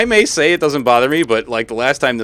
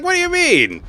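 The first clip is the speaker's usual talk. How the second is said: squeaky confused quote. Retold or imitated. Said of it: "I was like".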